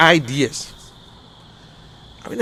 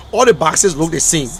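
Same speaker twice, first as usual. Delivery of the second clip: ordinary delivery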